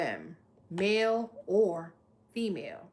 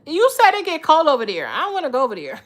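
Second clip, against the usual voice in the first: excited; 6 semitones higher, 11 dB louder, faster than usual. Real, not acted. Joking reply to viewer.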